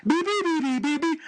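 high, quick beeping noises; keypad beeps. Retold or imitated. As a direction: beep like pressing keys. Imitating keypad typing.

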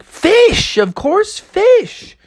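very high, emphatic squeak on "fish"; exclaimed obvious answer. Acted. Frog to shark about his diet, high incredulous answer. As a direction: state the obvious, voice shooting up.

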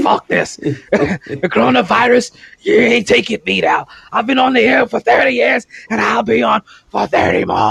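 shouted, drawn-out wrestling promo; Vince McMahon impression. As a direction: bellow it like a wrestling boss. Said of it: "He's like".

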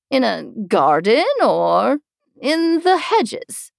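fussy queen. Acted.

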